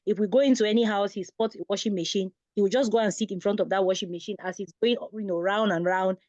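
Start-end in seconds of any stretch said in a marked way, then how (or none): none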